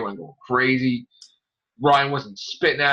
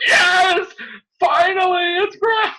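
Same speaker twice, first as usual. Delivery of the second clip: high, loud cheering shout; shouting yes at the screen; excited cheering; 5 dB louder, slower than usual. Retold or imitated. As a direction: cheer like your show finally got good. Excited fan cheering at episode.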